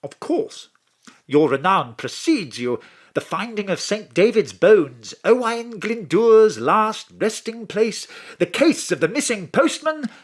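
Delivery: put-on smooth, flattering posh English accent; Jennings in posh English accent. Mr Jennings flattering the detective on the phone, in a posh English accent. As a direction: charm her like an English gent, posh English accent. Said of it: "a plumbing English accent".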